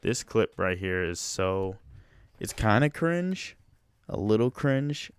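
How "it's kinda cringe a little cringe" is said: cringing. Real, not acted. Reacting to cringe clip.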